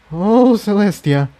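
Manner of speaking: awed mutter. A narrator's reading. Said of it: "Incendia muttered".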